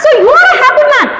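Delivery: high, amazed shout; excited shout. Retold or imitated. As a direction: burst out in surprise like the stable boy. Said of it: "and he shouted".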